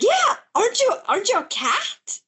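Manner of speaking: put-on high girlish voice; young girl. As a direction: ask it like a puzzled little girl.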